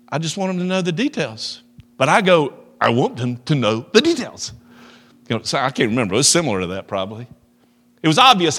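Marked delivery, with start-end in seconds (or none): 0.1-1.6 higher, gentle loving tone; said in love. Retold or imitated. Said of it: "say in love". 2.8-4.5 sharp retort. Retold or imitated. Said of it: "you spoke sharply".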